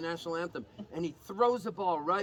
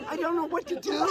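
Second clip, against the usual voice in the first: panicked; 9 semitones higher, 3 dB louder than usual. Retold or imitated. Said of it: "And I go".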